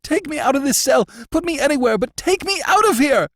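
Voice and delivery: high, loud, panicked begging; burst of terror. A narrator's reading. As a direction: explode into frantic pleading. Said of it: "in a sudden burst of terror".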